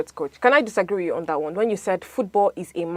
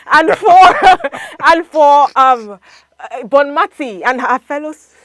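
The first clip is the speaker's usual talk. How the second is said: high, loud words broken by laughter; laughing while talking; 6 semitones higher, 13 dB louder than usual. Real, not acted. Panelist citing prize money to coach, cracking up laughing. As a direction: struggle to finish through real laughter. Both speakers break up laughing.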